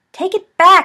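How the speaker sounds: high, loud playful shout; playful yell. Acted. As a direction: yell it while wrestling.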